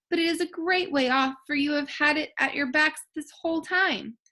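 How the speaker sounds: a hint of a high little mouse queen. A narrator's reading. Mouse queen telling Dorothy the way, lightly high. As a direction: lift the voice for the tiny queen.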